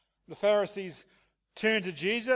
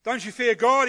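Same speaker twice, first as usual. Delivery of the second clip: loud, higher sharp rebuke; 5 semitones higher, 8 dB louder than usual. Retold or imitated. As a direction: scold the other criminal hard. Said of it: "his rebuke is one of".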